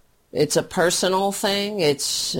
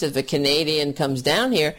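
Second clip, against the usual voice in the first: normal talking voice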